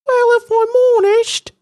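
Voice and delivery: a tiny shy voice, dodging the question; Tom Holland's little voice. Retold or imitated. Retelling Tom Holland dodging a classmate in a high little voice. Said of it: "in his little voice that he does".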